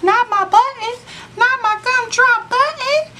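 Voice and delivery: very high, loud squeaky-voiced gingerbread man pleading; cookie man voice. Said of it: "So I be like".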